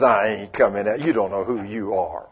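devil voice. Retold or imitated. The devil refusing a timid believer, low and mocking. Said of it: "The devil says".

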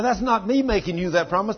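ordinary talk, nothing marked

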